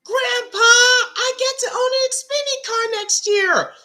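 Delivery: put-on very high, shouted kid-like voice; a boastful grandson, squeaked out; young Ty voice. Voicing Ty to grandpa.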